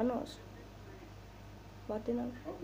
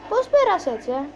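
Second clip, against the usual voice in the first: surprised; 7 semitones higher, 9 dB louder than usual. Real, not acted. Amazed by a car stunt.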